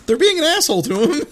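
high fast exasperated aside; emphatic. Real, not acted. Complaining about the movie, high fast. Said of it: "emphatic aside".

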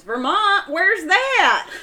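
high, loud disbelief with a laugh; retold Virginia woman baffled by Vermont; incredulous question. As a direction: play the clueless stranger, amazed. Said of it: "She goes".

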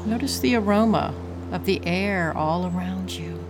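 calm, unhurried breathing guide; guided meditation. Acted.

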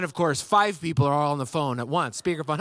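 usual speaking voice

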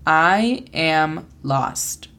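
"I am lost" spoken very slowly, each word apart; slow English I am lost. Shown as a demonstration. Teacher models "I am lost" for learners, slow careful English. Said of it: "La pronunciación fonética es A I E A M L A S T".

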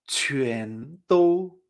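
QUAN DOU as "chü-en tou", rising then level tone; pronunciation demo. Shown as a demonstration. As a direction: say QUAN DOU slowly, second tone then first. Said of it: "Se pronunciaría".

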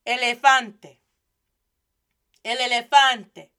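elefante with pure Spanish eh vowels, loud; model pronunciation. Shown as a demonstration. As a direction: elefante stressed hard on FAN. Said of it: "Repeat after me".

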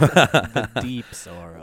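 mock deep sorrow. Mock mournful joke.

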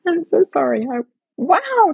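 choked up, cracking upward; tearful. Real, not acted. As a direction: voice breaking high with emotion. Overcome with emotion, apologizing.

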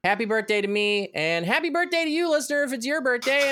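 ordinary delivery

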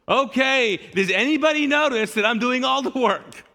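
high, loud sarcastic call-out; passive-aggressive. Retold or imitated. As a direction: announce it to the whole house. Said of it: "passive aggressive".

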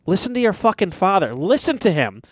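mild acted yell; retold scream. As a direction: imitate an exasperated scream without full volume. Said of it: "He's screaming at himself".

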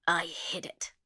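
ordinary delivery

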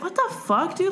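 high incredulous "what the fuck, dude"; outraged reader's squeal; audible outrage. Retold or imitated. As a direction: blurt it out in disbelief. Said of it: "Like, audibly".

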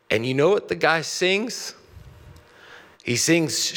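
ordinary delivery

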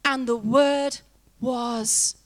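slow, weighty Bible reading; scripture reading. Acted. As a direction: lay each phrase down slowly and solemnly. Reads scripture aloud.

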